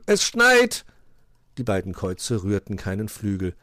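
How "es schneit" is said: loud, high-pitched announcement; calling out. A narrator's reading. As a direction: shout the news to sleepers. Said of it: "rief Wuschel".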